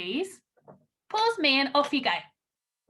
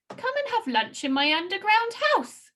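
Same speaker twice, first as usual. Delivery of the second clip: fox character voice; 7 semitones higher than usual. Acted. Rereading Fox's line.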